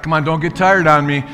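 normal talking voice

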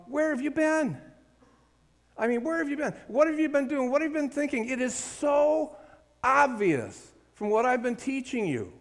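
Paul asking his church where they have been; exasperated Paul. Retold or imitated. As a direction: ask in disbelief as a frustrated teacher. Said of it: "almost like Paul is asking".

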